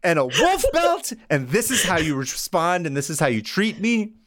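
high, hurt indignant voice; offended Satan. Acted. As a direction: whine it like a wounded host.

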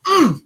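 squeaky pent-up mad hum; a tight, high little grunt; high angry grunt. Retold or imitated. Mocking Gobert's mild anger with a high hum. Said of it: "could be mad and be like".

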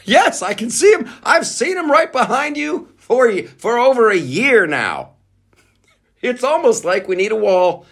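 high, mocking sarcasm; sarcastic. Host mocking the border video, high sarcastic voice.

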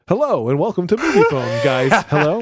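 mock Movie Phone intro; Movie Phone greeting. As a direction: boom it out like the phone line's welcome. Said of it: "similar to the".

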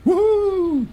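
high whooping cheer; excited whoo. Real, not acted. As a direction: whoop for the successful roll. Cheering a passed roll.

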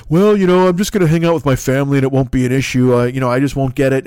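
casual dismissive jock voice; mock ballplayer. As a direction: shrug it off lazily. Mocking ballplayer quote.

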